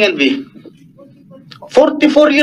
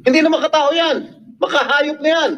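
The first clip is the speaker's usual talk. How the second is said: heated scorn; 4 semitones higher than usual. Real, not acted. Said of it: "raising my voice".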